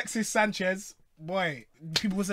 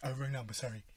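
mocking the caller voice; 5 semitones lower, 11 dB quieter, faster than usual. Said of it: "that's the deadest accent ever".

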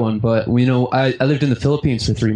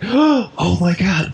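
awestruck; 6 semitones higher, much slower than usual. Retold or imitated. Awed by seeing [name].

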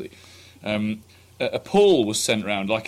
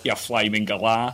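lower, slow Australian insult; Australian accent; 5 semitones lower, slower than usual. Pretend. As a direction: drawl the jibe in broad Australian. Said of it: "Give me an Australian one".

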